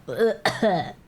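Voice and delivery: ordinary delivery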